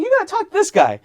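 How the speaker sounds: high, loud eager urging; excited attorney. Retold or imitated. Attorney urging colleague to meet him, high and eager. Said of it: "he's like".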